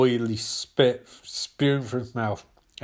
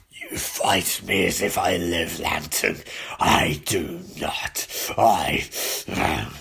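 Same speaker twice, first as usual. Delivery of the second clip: much lower, slow, strained rasping voice; Necron's death voice; 9 semitones lower, slower than usual. Acted. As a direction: speak as death itself, dragging each word. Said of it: "Oh my God, my throat".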